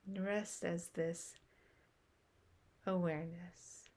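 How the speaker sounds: usual speaking voice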